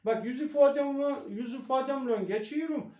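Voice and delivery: loud, high-pitched Karadeniz dialect. Shown as a demonstration. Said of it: "Mesela ben Karadenizli'ye geçeceğim".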